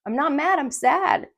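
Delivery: loud, high, insistent correction; reenacted exasperated insistence; emphatic protest. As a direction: protest forcefully, as if not being understood. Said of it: "And I told her that".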